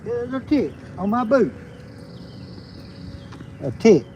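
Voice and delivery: ordinary talk, nothing marked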